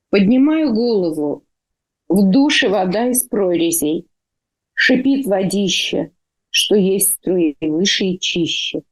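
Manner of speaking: intoned verse, each line lifted then falling; poem recitation. Acted. Poet reads a painful poem addressed to the godmother, intoned recitation. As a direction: recite it with swelling openings and pauses between lines.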